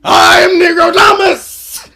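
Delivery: slow shout while cracking up; laughing shout. Real, not acted. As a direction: yell it out through laughter. Laughing outburst after clip.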